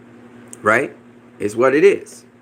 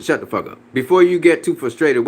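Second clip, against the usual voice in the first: ordinary talk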